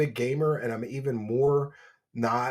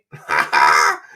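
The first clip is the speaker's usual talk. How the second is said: gloating laugh; 10 semitones lower, 11 dB louder than usual. Acted. Gloating before a chant.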